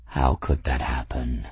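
low, lofty cat voice; proud cat. Acted. Cat answering grateful Bridget, low and lofty. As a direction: speak as a cat who knows he's perfect.

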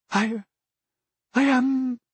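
high, hesitant voice, trailing off; faltering lady. Acted. Daughter stunned by the proposal, high and hesitant. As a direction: falter and leave it unfinished.

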